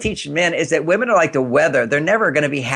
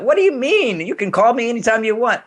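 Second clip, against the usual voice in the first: mock defensive husband; 7 semitones higher than usual. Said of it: "I could have immediately went".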